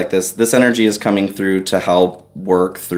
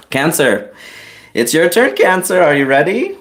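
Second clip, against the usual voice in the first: excited call; 8 semitones higher than usual. Real, not acted. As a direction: perk up and invite Cancer in. Calling next sign for reading.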